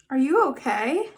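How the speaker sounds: ordinary delivery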